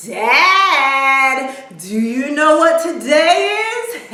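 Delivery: loud, high singsong call to Dad; excited daughter. Retold or imitated. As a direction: imitate a daughter bursting with news. Excited daughter greets dad, acted.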